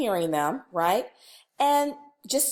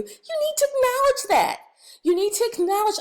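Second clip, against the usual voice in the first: high, louder insistence; emphatic; 10 semitones higher, 4 dB louder than usual. Real, not acted. Trainer insists staff must acknowledge a crying student. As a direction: drive the point home. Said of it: "emphatic instruction".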